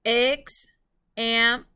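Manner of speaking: "example" slowly sounded out in three pieces, loud; syllable by syllable. Shown as a demonstration. Teacher helping pupils spell, "example" split syllable by syllable. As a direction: break "example" into separate syllables with clear gaps. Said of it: "Let's tap out the syllables for example".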